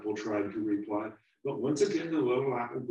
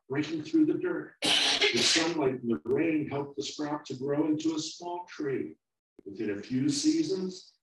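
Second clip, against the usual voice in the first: put-on older apple, wise; 6 semitones higher, 6 dB louder than usual. Older apple explains the future.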